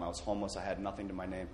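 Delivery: quick, quiet run-through; hurried, dropping in volume; too fast. Real, not acted. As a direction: rush through it softly. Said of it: "Too fast".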